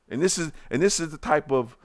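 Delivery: usual speaking voice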